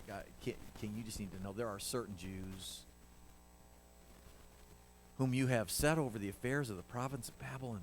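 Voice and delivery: soft, hesitant, whining tattletale; hushed, nervous whining to the king; whiny voice. Acted. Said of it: "you have to hear their whiny voice".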